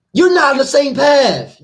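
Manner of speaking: high, loud, drawn-out scolding; loud rebuke. Acted. The Lord turning away false followers, loud rebuke. Acts out the Lord rejecting believers.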